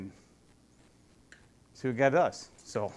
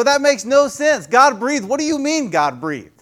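millennial with attitude; 7 semitones higher than usual. Acted. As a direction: push back like a skeptical young millennial. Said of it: "millennial who has got an attitude".

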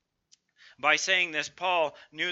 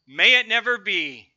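loud, forceful declaration, voice raised high; emphatic denial; 5 semitones higher, 11 dB louder than usual. Acted. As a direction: proclaim it with full force. Preacher quoting Paul's emphatic denial.

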